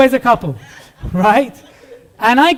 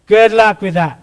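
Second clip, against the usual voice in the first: sarcastic brush-off, a bit louder; 5 dB louder than usual. Retold or imitated. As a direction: toss it off with mock cheer. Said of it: "I was like".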